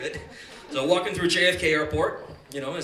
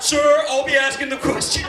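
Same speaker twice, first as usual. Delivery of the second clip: loud, higher curt officer voice; stern officer; 8 semitones higher, 8 dB louder than usual. Retold or imitated. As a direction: shut the kid down flatly. Officer cuts off the kid.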